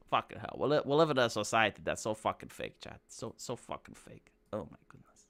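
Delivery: low, soft, deflated; weary dismay. Real, not acted. Streamer lamenting fake society to chat, weary dismay. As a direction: let the voice sink almost to nothing.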